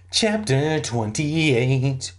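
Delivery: slow, drawn-out chapter title; chapter announcement. Acted. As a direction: stretch out each word of the heading. Announces the next chapter grandly.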